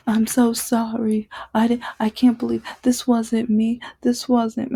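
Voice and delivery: hammed-up teary plea; mock fake crying. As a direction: imitate a boyfriend faking tears. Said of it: "he would call me crying, like, fake crying".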